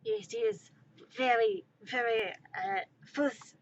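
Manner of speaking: halting, mock-wounded French accent; fake French accent. Smuggler betrayed by his country, fake French accent. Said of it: "my terrible fake accent".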